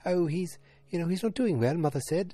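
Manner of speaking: high-pitched imitation of the wife; Lane's wife. Wife reporting on her sick father, imitated high. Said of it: "'cause she's like".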